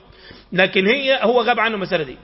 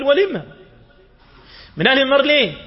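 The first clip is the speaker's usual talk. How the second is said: surprised question; 4 semitones higher, 3 dB louder than usual. Acted. Prophet asks why, surprised.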